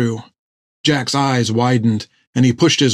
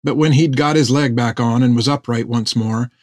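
usual speaking voice

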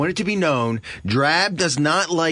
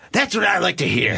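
higher, brighter voice for the fan; pleased fan voice; 8 semitones higher, 3 dB louder than usual. Retold or imitated. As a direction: act the happy fan. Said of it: "Then you go".

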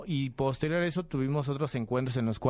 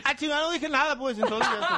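high, louder gruff voice with a laugh; Julio César Chávez voice; 13 semitones higher, 4 dB louder than usual. Retold or imitated. The boxer admits it, in his gruff voice. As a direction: give in like the champ, laughing. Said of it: "empecé a hacer la voz".

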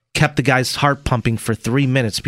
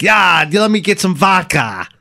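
higher, hammy Russian accent; mock Russian accent; 6 semitones higher, 3 dB louder than usual. Shown as a demonstration. Co-host demonstrating for his partner, Russian accent. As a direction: show off a cartoon Russian accent. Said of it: "let me hear your best Russian accent".

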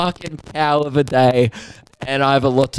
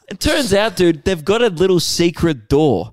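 ordinary delivery

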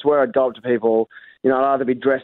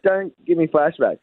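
ordinary delivery

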